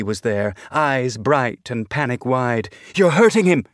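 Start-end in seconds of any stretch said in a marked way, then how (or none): 2.9-3.6 high, loud panicked yell; panicked shout. A narrator's reading. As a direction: cry out in alarm to stop him. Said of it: "she shouted".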